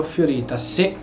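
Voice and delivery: ordinary talk, nothing marked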